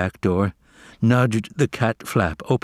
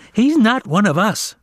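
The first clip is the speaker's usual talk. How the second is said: high, sharp jeer; high-pitched taunting cat; 12 semitones higher, 4 dB louder than usual. A narrator's reading. Jerome concluding, high.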